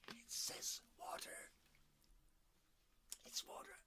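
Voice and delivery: soft voiceless rasp; hoarse whisper. Real, not acted. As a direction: whisper through a sore throat. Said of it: "having destroyed your voice like that".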